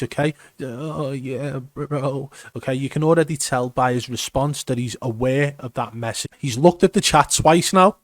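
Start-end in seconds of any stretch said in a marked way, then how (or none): 0.6-2.3 softer, mock-nervous wobble; imitated shaky voice. Commentator mimicking the suspected cheater's response, shaky and nervous. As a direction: imitate a scared, shaky reply. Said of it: "he's got a shaky voice".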